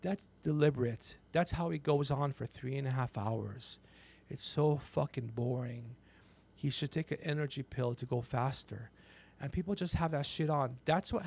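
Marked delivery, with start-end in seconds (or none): none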